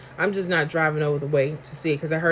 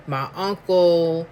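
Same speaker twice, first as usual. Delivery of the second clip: ordinary talk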